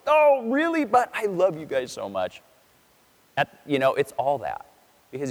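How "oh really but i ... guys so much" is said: exasperated but loving. Retold or imitated. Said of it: "he's probably going".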